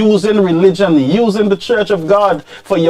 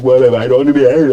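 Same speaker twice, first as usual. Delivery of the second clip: fast, bored mock grumble, lower; bored churchgoer imitated; 7 semitones lower, much faster than usual. To the preacher, mock bored churchgoer rushing off. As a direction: rattle it off like you can't wait to leave. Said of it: "he's like".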